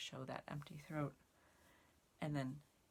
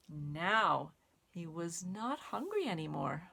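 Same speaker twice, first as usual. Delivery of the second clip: lively storybook read-aloud with counting; reading to children; 3 dB louder, slower than usual. Acted. Teacher reading the caterpillar book to children, lively storytime voice. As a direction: read it like storytime for little kids.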